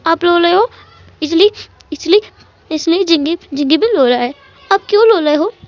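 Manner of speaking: put-on little kid sobbing his questions; crying little boy. Small child cries and asks his uncle why he is crying.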